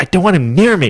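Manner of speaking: high-pitched mock complaint; disgusted girl. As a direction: imitate a girl recoiling from someone. Girls' disgust, retold.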